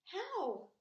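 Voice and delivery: high, sharp eager ask; eager question. A narrator's reading. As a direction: ask it breathlessly. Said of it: "asked Wilbur".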